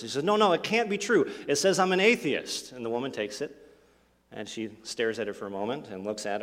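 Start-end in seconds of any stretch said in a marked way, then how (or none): 0.2-2.7 shocked protest. Acted. Woman shocked by test result.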